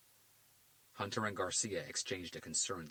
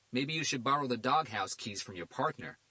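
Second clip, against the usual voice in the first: sarcastic jab; 5 semitones higher, 5 dB louder than usual. A narrator's reading. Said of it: "tone that sarcasm of yours way".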